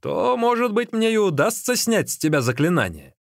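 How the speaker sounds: high, teasing suggestion; with a smirk. A narrator's reading. Magician hints he may lift the spell, with a smirk. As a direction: hold out hope slyly. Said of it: "сказал тогда с усмешкой Дайдзун".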